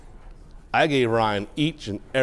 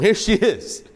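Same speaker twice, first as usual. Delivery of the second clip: normal talking voice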